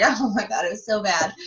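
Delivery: usual speaking voice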